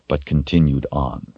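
usual speaking voice

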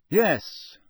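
high, loud one-word answer; almost defiant. A narrator's reading. Boy answers his teacher, almost defiant. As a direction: answer firmly, nearly challenging. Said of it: "almost defiantly".

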